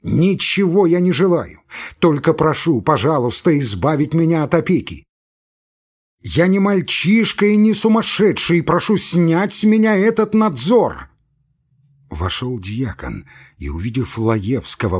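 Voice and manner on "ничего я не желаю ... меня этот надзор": rising from complaint to a high, loud outburst; building furious shout. Acted. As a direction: explode by the end, slowing on the last words. Laevsky keeps shouting at friends.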